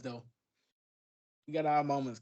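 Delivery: usual speaking voice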